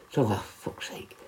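muttered whisper; whispered exasperated curse; muttered under breath. Retold or imitated. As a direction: say it to yourself, barely voiced. Realising he was wrong.

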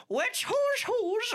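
put-on high, slow, quavering old lady. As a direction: croak it out like a frail granny. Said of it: "do it in the old lady voice that we had".

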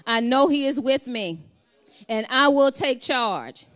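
loud, high shouted affirmation, each line punched; preached declaration. Acted. Preacher leading the church in a declaration, shouting it. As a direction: declare it like a rallying cry.

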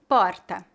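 Porta with a light tapped R, /pɔɾtɐ/; Portuguese: porta com R fraco; weak R. Shown as a demonstration. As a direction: tap the tongue once for the R in Porta. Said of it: "R fraco".

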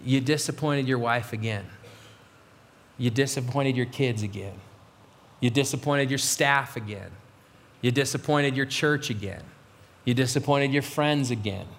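low, measured self-reproach; low, heavy drumbeat of blame; three a.m. inner voice. Acted. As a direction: accuse yourself in a low late-night voice. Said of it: "This is my three o'clock voice".

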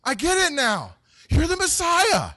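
very high, loud, astonished outburst; over-the-top eureka shout; Peter/character's excited realization. Acted. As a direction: gasp and blurt it out in hammed-up amazement. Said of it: "And I think Peter said".

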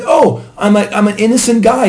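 imitating Pete protesting his innocence; mock innocence. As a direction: ham up a defensive innocent guy. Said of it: "He's like".

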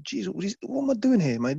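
high-pitched, overwhelmed self-talk; awed disbelief. Retold or imitated. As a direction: voice climbs as you doubt you belong. Awed self-talk on seeing star players.

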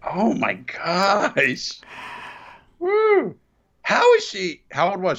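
very high, drawn-out shocked cry. Real, not acted. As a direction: squeal in creeped-out disbelief. Photo looms on the screen.

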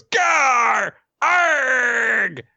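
high, drawn-out cartoon yell of pain; hammed-up "aargh" held long and high; pained cry. Amato as a claw plunges into his shoulder, hammed-up pained cry.

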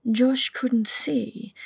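usual speaking voice

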